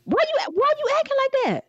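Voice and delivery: high indignant why. Pretend. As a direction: sound offended and shocked. Mock offended at cohost.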